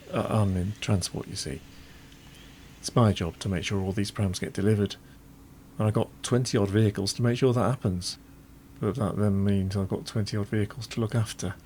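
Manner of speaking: chatty Yorkshire transport manager at work; busy, firmly projected northern working man's monologue; Noel. Acted. Veteran factory transport manager showing a visitor round, proud and chatty. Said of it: "we get to hear from Noel".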